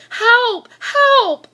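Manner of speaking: high, loud cry for help; shouting for help. Acted. Boy shouting to the policeman for help, loud. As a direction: yell it out, alarmed. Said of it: "I said".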